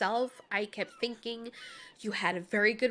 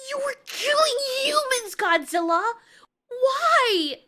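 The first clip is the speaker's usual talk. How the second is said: high, loud outraged cry; anguished shouting; 10 semitones higher, 7 dB louder, slower than usual. Acted. Mothra demands why Godzilla killed humans, shouting. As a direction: shout it in horrified disbelief. Acted quarrel over killing humans.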